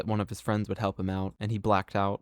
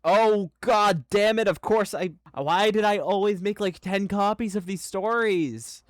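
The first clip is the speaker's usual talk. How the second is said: real high-pitched frustration; exasperated; 10 semitones higher than usual. Real, not acted. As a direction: complain in amused despair at yourself. Exasperated at own duplicate files.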